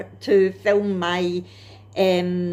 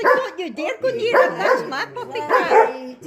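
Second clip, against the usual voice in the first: protective cat voice; 11 semitones higher, 3 dB louder than usual. Retold or imitated. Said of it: "It's like".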